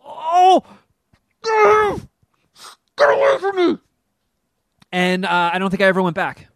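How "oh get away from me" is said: much higher, louder recoil; mock panic. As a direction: cry out to get her away in mock fright. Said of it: "and I'm just like".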